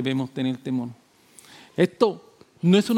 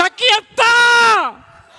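much higher, loud, slow triumphant cry; joyful shout; 11 dB louder, much slower than usual. Acted. The Father answering the seeker, a loud slow triumphant shout. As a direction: shout it out like handing over a gift. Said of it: "el Padre dice".

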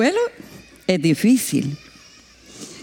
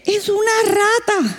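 blunt reveal; 10 semitones higher than usual. Retold or imitated. Missionary's revealing answer.

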